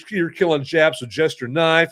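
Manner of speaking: ordinary delivery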